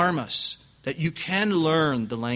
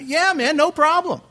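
very high, loud accent impression; Steve Lawson's accent; 6 dB louder than usual. Visiting preacher agreeing to help, in exaggerated accent. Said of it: "with that accent".

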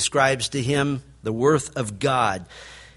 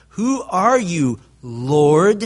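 slow, awed, with a louder Lord; awed question; 2 semitones higher, 5 dB louder, much slower than usual. Retold or imitated. Saul, blinded on the road, asks the voice slowly in awe. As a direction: ask it slowly, overwhelmed. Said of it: "he asked the logical question".

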